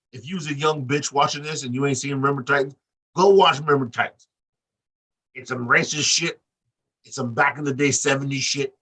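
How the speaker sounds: usual speaking voice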